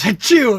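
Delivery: mock sneeze. Friend imitating the rage-quit sneeze, loud fake sneeze. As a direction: blast out a hammed-up sneeze. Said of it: "He goes".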